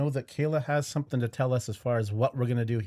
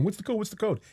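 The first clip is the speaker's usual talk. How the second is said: mock clamouring, pitched much higher; imitated eager listeners; 8 semitones higher, faster than usual. Host imitating people asking for the code, mock eager clamour. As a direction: imitate impatient fans in a higher voice. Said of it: "there's a lot of people going".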